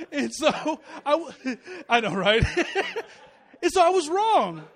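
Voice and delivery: laughing while confessing he was wrong. Real, not acted. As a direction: laugh as you admit being wrong. Laughing at his own mistake.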